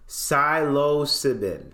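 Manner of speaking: ordinary talk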